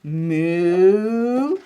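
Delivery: long moo sliding upward; slow, stretched cow moos. Acted. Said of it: "Something that goes".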